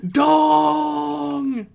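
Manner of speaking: mock shout. Echoing the joke shout.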